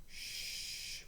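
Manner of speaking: whispered shush; gentle shush. Acted. Librarian hushing the children, whispered. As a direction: hush the room softly. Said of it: "she said nicely".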